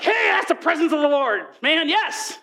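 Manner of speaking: high, wowed acted cheer; excited exclamation. Preacher acts out seeing the presence of the Lord, excited. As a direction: call it out like an amazed onlooker. Acts out awe at mighty wind.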